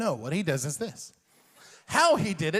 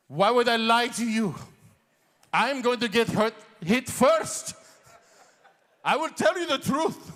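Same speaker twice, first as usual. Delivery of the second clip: higher, louder pleading dad; insistent dad; 6 semitones higher, 5 dB louder than usual. Retold or imitated. Dad insisting to his son at the stop sign, higher pleading. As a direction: swear you'd never lie.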